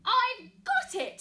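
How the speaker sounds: high, loud and slow eureka; excited mouse. Acted. Mouse announcing his idea, loud and high. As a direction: burst out with the big idea. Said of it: "he said".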